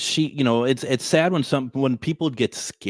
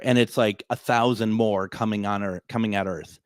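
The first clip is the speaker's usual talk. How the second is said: ordinary delivery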